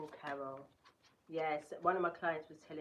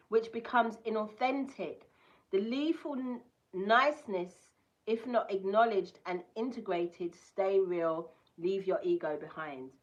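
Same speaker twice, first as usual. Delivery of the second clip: louder, proclaiming the card's text; card reading voice; 4 semitones higher, 9 dB louder than usual. Acted. Reading the card's message to viewers, proclaiming. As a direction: deliver the message like an oracle.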